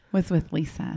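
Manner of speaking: slow, hammed-up sexy hotline voice; sultry phone-line voice. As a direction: play it like a hotline operator. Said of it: "Use your one nine hundred voice".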